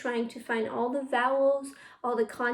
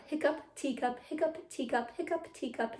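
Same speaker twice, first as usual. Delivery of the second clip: quick-fire tongue twister, softer and run together; fast and clear; 2 semitones higher, 6 dB quieter than usual. Shown as a demonstration. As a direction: rattle it off lightly, keeping it crisp. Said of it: "see how fast you can go while still staying very clear".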